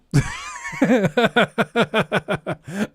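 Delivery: high, drawn-out cackling laugh; helpless run of laughter. Real, not acted. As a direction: burst out laughing and keep going. Laughs at his own accent.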